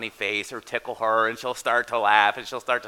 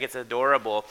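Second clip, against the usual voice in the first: normal talking voice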